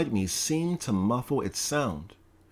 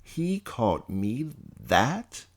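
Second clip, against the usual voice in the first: slow, rising disbelief with stress on "He"; "He called me that?" asked slowly, rising on "He"; incredulous question; slower than usual. Acted. Narrator stunned by the insult, asking himself in disbelief.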